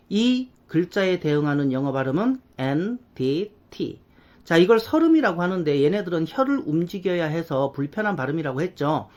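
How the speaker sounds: ordinary delivery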